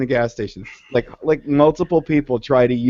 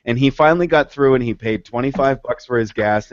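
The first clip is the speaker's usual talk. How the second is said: ordinary delivery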